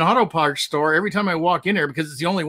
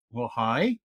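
low soft greeting; 7 semitones lower, 6 dB quieter than usual. Pretend. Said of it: "How am I gonna say it?".

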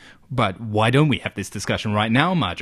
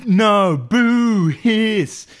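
hammy boo; 10 semitones higher, 5 dB louder, much slower than usual. Acted. Hammy booing.

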